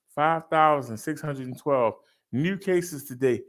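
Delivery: ordinary delivery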